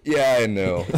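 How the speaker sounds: laughing as he admits it. Real, not acted. Laughing confession to mom.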